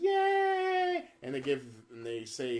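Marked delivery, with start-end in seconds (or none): 0.0-1.0 very high mock crowd "yay"; crowd cheer. Imitating the E3 crowd cheering at the reveal. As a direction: squeal like a cheering fan.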